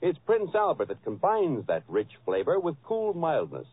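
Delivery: ordinary talk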